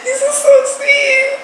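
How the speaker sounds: very high voice, close to crying; tearful thanks. Real, not acted. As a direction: squeak out thanks through happy tears. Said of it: "I said I wouldn't cry".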